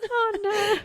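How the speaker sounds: high-pitched, helpless laughter around the words; cracking up. Real, not acted. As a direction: squeak out the joke while losing it laughing. Cracks up at own joke.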